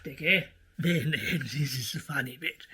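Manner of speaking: normal talking voice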